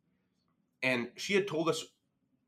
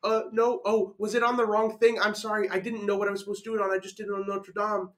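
higher-pitched, flustered excuse-making; flustered apology; 6 semitones higher, 4 dB louder than usual. Retold or imitated. Student excusing himself to angry teacher, flustered. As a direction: plead innocence in a mock-panicked voice.

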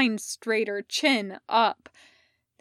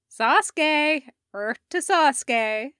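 high, loud call for attention; 4 semitones higher, 6 dB louder than usual. Acted. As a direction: yell to snap him out of it. Calling out to distracted friend.

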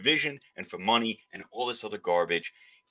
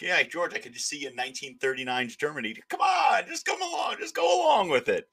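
mock Seinfeld character; 5 semitones higher than usual. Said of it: "Again, another Seinfeld reference".

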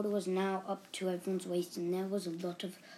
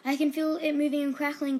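loud, high, breathless gasping alarm; gasped; 7 semitones higher, 8 dB louder than usual. A narrator's reading. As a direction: gasp it out in fright. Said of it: "gasped Bobby".

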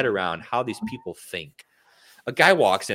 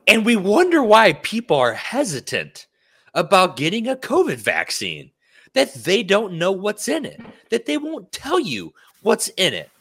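higher-pitched, worked-up exasperation; indignant rant; 6 semitones higher, 4 dB louder than usual. Real, not acted. Host ranting to listeners about vaccine hesitancy, indignant. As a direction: launch into an incredulous tirade, pitch climbing.

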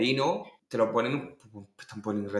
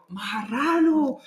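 high, long drawn-out insult; imitated shocked heckle; mock scolding cry; 12 semitones higher, much slower than usual. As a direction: call it out slowly, scandalized. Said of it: "cuando se enteraron".

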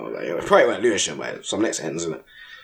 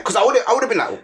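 ordinary talk, nothing marked

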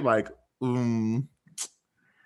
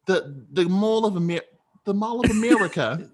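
high-pitched exaggerated Wisconsin accent; 7 semitones higher than usual. Friend testing the next regional accent, Wisconsin accent. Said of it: "Do we got a Wisconsin?".

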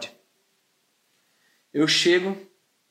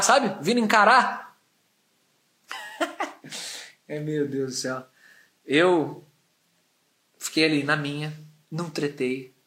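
ordinary delivery

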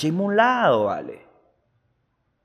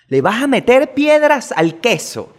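indignant disbelief; 8 semitones higher, 5 dB louder, slower than usual. Real, not acted. Indignant repeat of question.

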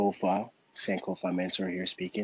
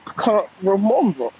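playful attempt at a British accent, pitched up; British accent try; 11 semitones higher, 9 dB louder than usual. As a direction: repeat it in a mock British accent, higher. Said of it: "Try to work on my British accent".

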